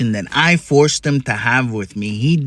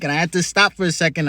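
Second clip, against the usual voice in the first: ordinary delivery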